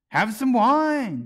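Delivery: high, loud, slow coaxing; encouraging. A narrator's reading. March Hare coaxing Alice to drink, high and loud. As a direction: urge her sweetly to take some. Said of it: "the March Hare said encouragingly".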